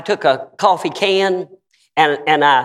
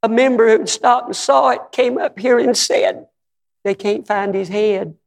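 imitating sobbing wife; 7 semitones higher than usual. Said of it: "She was sobbing".

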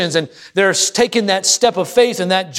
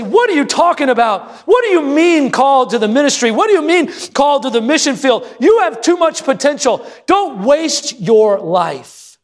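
indignant parents; 6 semitones higher than usual. Retold or imitated. Parents refusing a child's call to ministry, shouting indignantly. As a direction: scold the child like furious parents. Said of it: "filled with indignation and say".

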